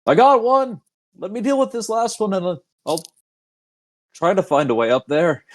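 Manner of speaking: high-pitched call to unseen buddies; yelling out. Acted. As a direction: call out to friends behind walls, pitched well up. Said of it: "he probably just yells out".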